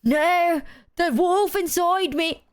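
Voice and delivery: dramatic high cry. Retold or imitated. His part in the mock edgy song, anguished. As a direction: ham up an anguished line. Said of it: "right, I go".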